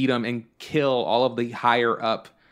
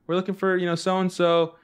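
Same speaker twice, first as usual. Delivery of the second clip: higher, sweet lady voice; women callers; 7 semitones higher than usual. Retold or imitated. Women callers at the gate, lightly high and sweet. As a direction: chirp it like a hopeful visitor at the gate. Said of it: "be like".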